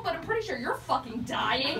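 normal talking voice

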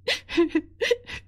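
high broken sob; sobbing cry. Acted. Hina sobbing to her mother over ruined henna. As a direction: let out a sharp tearful sob. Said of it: "रोने लगी".

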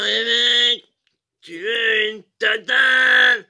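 drawn-out shouted challenge, slow and loud; yelled slow taunt across the bar; mock shout. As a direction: bark it out like a bar brawler. Tatane challenges a stranger.

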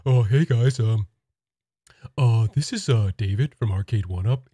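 lightly voiced dopey salesman; hesitant, slightly goofy introduction; Patrick. Arcade One Up rep greeting Nintendo, lightly Patrick-like voice. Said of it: "sounds like Patrick from SpongeBob".

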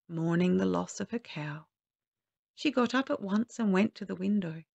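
ordinary delivery

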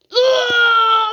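loud high scream. Acted. To the yelling monster, a loud high scream. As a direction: let out a full scream. Man shouts at him.

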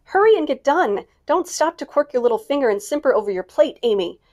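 high, loud eager urging; impatient cry. Acted. Jo impatient to hear the letter, eager loud cry. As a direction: hurry them along loudly. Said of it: "cried Jo".

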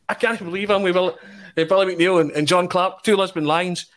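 mock the overexcited superfan; gushing fan. Elton gushing over the Lions.

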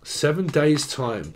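ordinary delivery

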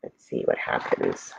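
hushed suspense whisper. Real, not acted. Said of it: "teacher teases suspense".

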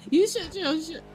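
ordinary talk, nothing marked

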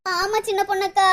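low, soft crying voice; tearful. Acted. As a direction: admit it quietly through tears. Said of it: "அப்புறம் எதுக்குடி அழுகுற". (அழுகையோடு மெல்லிய குரலில்)

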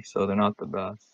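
ordinary delivery

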